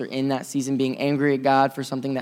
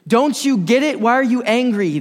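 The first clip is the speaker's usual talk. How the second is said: Paul's frustrated plea; 8 semitones higher, 6 dB louder than usual. Retold or imitated. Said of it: "the biggest reason he says is this".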